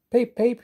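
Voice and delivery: high-pitched engine whistle, spoken; high, piping toots; whistled peep. A narrator's reading. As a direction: peep like a little train whistle. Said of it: "he whistled one morning".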